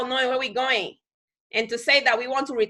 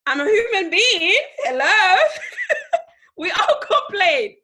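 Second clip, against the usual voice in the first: higher, louder, breaking into laughter; laughing protest; 7 semitones higher, 5 dB louder than usual. Real, not acted. Speaker denying she never complains, laughing protest. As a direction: protest through a real laugh.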